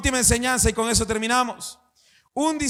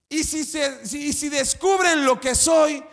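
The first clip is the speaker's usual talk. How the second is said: louder, higher fearful cry of shame; panicked inner voice; 5 semitones higher, 4 dB louder than usual. Retold or imitated. Preacher voicing a believer's shame, panicked shout. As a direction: shout the fear of being found out. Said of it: "Sientes que".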